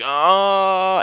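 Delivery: short imitated zombie groan; zombie moan. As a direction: groan it out like the undead. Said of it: "Can you moan it one more time".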